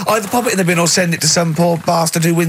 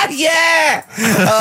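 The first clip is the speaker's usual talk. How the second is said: laughing yeah. Real, not acted. Laughing hard at the joke.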